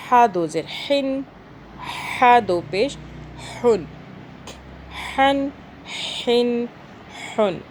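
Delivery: ہا and ہن with a strong rasped H from mid-throat; throaty ha. Shown as a demonstration. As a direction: push each H in ہن through a narrowed throat. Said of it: "تھوڑا سا پیچھے سے آئے گی". (ہا حلق سے، رگڑ کے ساتھ)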